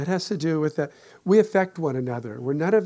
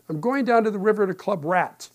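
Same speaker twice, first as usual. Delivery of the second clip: grumpy retort; 4 semitones higher, 5 dB louder than usual. Retold or imitated. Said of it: "I was in a terrible mood".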